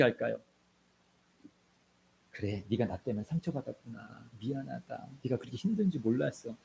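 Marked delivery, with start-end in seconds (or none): 2.3-6.5 soft, tender mother's apology; gentle apologetic parent. Retold or imitated. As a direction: say it softly, as the ideal parent. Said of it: "이것은 교과서예요". (부드럽게 사과하는 엄마 목소리)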